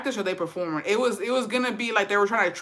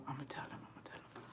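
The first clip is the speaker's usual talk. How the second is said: fast unvoiced whisper; 6 semitones lower, 26 dB quieter, faster than usual. Real, not acted. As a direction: hiss it quickly like a secret. Secret plan to test him.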